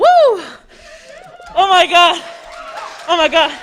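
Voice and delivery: high, loud disbelief; excited. Real, not acted. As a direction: gasp it out overwhelmed. Overwhelmed accepting award.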